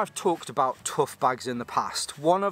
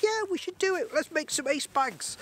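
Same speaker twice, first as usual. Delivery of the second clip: much higher falsetto voice for the wife; wife's voice; 14 semitones higher than usual. Retold or imitated. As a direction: play the eager wife in a high squeaky voice. Wife answers in story.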